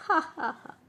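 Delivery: high, spelt-out mock laugh; acted laughter. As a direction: pretend giggle escaping mid-apology. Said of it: "trying to control his laughter".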